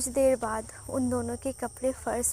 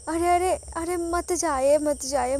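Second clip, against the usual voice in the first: higher and louder, hurried plea not to leave; urgent wife pleading, raised voice; anxious calling out; 5 semitones higher, 6 dB louder than usual. A narrator's reading. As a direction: call him back before he walks out. Wife stops husband from leaving.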